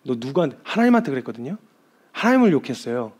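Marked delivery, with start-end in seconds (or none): none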